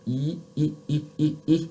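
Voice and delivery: e as a plain spread ee, lips not yet pushed; unrounded ee sound. Shown as a demonstration. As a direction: start on a spread ee before rounding. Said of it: "you start by going".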